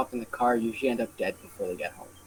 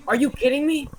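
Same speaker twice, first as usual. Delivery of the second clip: outraged; 11 semitones higher than usual. Retold or imitated. Drunk lady outraged.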